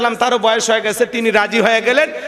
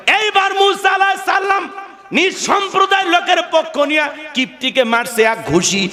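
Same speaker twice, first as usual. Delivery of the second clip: much higher preaching shout; fervent shout; 9 semitones higher than usual. Real, not acted. Preacher roars the punch to the crowd, shouting. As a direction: throw the line out at full force. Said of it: "preacher shouts narration".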